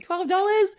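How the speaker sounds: high, loud pleading voice; mock asking a stranger for money; imitating a beggar.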